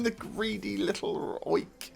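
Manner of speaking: slow, drawn-out fond insult; mock indignation. Real, not acted. Gamer mock-scolding a greedy player, slow and amused. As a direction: scold him with amused disgust. Player shoots instead of passing.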